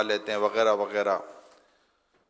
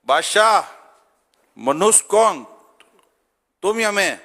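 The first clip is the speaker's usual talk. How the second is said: shouting up at the king, much higher and louder; loud call; 9 semitones higher, 10 dB louder, slower than usual. Retold or imitated. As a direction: yell it out to a passing procession. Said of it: "زور سے آواز لگے".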